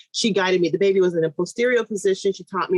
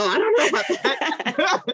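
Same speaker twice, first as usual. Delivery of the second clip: slow, high words through a laugh; amused, laughing disbelief; 8 semitones higher, slower than usual. Real, not acted. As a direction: laugh it off while saying it. Laughing off the baby count.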